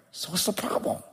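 very soft, almost whispered question; gentle hushed question. Retold or imitated. Dad to his upset daughter, gentle hushed question. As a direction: ask it softly, barely voiced.

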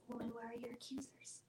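hushed, breath-only retelling of a tender question; very soft storytelling whisper; gentle whisper. Retold or imitated. Jesus speaking to the woman caught in adultery, gentle whisper.

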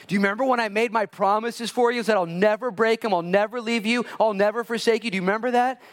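Jesus reminding Luke of his promises; passionate Lord. Acted. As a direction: build the promise into a cry. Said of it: "And he says".

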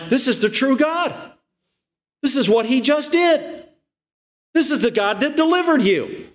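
high, emphatic declaration; fervent announcing, pitched well up; insistent proclamation. Retold or imitated. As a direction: insist on it to the people. Voicing Aaron's conviction about the idol.

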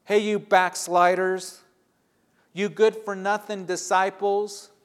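loud, high scolding shout; harsh accusing yell at runaway disciples; angry rebuke. Retold or imitated. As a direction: berate them as rebels and backsliders. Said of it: "He doesn't say".

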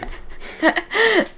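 high squeaky hiccuping laugh. Real, not acted. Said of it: "A hiccup laugh".

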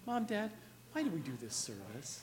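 put-on polite child. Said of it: "I wish our children would talk like that".

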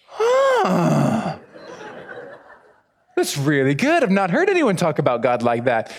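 hammed-up surprise from the group; mock amazed huh. The group reacts to hearing about God, surprised. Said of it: "they're sitting there like".

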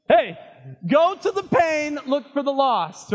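loud, high, slow exhortation; fired-up preaching. Real, not acted. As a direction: preach it out at full force. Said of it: "I'm getting fired up".

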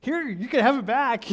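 cheerful give-back. Retold or imitated. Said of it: "we're like".

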